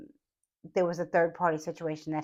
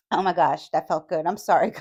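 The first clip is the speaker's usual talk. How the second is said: ordinary delivery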